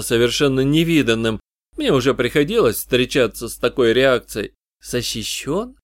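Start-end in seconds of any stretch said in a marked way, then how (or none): 4.8-5.8 barely squeezed out, softer; forced out. Acted. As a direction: strain to get the word out. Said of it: "с трудом выдавила она".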